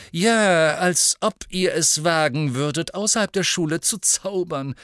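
high, loud jeer; sneering schoolboy taunt. A narrator's reading. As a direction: taunt them with contempt. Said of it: "höhnte Malfoy".

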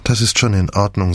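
plain reading voice

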